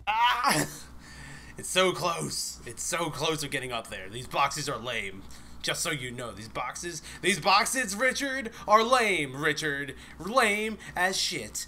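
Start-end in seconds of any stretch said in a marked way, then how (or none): 0.1-0.7 short, high, loud scream; frustrated scream. Real, not acted. Frustrated scream at failed climb. 7.2-11.6 loud, high, emphatic complaint; exasperated rant. Real, not acted. Rants at Richard about boxes.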